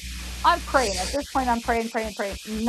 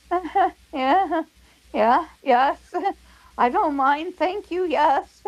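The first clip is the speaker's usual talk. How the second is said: higher, eager yes through little laughs; giggly acceptance; 5 semitones higher, slower than usual. Retold or imitated. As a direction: giggle while gladly accepting. Retelling her eager giggly acceptance.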